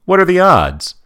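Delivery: bright cartoon-kid exclamation; mimicking Phineas. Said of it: "And Phineas says".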